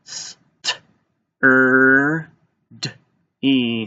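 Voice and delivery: "S, t, er, d, e" each sound separately. Shown as a demonstration. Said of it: "Let's tap this one out".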